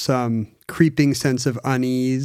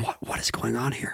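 hushed startled voice; 4 semitones lower, 11 dB quieter than usual. Retold or imitated. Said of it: "You would be like".

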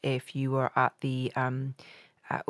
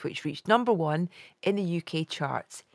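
ordinary delivery

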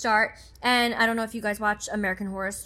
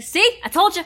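smug gloating; 9 semitones higher, 6 dB louder than usual. Pretend. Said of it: "I'd be like".

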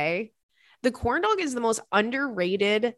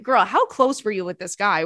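ordinary delivery

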